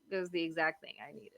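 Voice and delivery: soft, quick repeat to herself; quiet aside. Real, not acted. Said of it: "and she knew it was the exact thing I needed".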